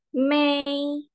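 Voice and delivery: "may" held high and level; first-tone demo. Shown as a demonstration. Teacher summing up the tones, high level "may". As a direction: keep "may" on a high, steady note. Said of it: "dia tinggi nadanya".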